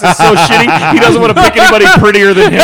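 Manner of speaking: laughing loudly while talking; cracking up mid-sentence. Real, not acted. Teasing James amid laughter.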